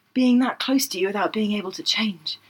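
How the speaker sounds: hushed seductive whisper; mock-sultry whisper. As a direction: breathe it like a lover. Reading romance line.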